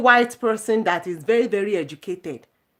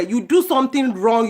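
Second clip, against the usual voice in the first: usual speaking voice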